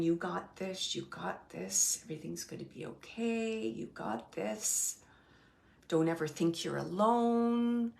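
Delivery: low, hushed comfort; quietly steady and soothing; soft reassurance. Retold or imitated. A medium relays a spirit's comfort, soft reassurance.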